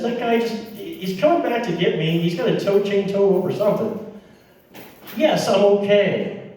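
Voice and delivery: high-pitched, agitated explanation; exasperated. Retold or imitated. Stuck driver explaining to second stranger, exasperated.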